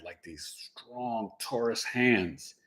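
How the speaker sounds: usual speaking voice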